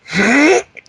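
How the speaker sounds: high straining cartoon grunts; imitated hero grunts. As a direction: grunt as if heaving something heavy. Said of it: "the same three grunts".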